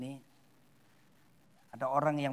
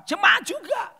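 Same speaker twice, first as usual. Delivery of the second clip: high, loud call-out; emphatic shout; 9 dB louder, slower than usual. Real, not acted. Preacher naming church members as hypocrites, emphatic shout. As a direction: shout it out at the congregation.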